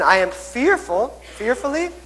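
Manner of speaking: fearfully squeaked high, wonderfully lifted; playful demo for kids; mock fearful then wonderful. Shown as a demonstration. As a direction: act scared then amazed. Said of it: "And then".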